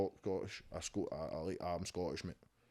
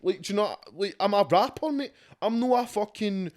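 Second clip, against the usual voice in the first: high, loud indignant ranting; heated rant; 6 semitones higher, 11 dB louder than usual. Real, not acted. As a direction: rant at full volume, outraged. Heated rant about racism accusation.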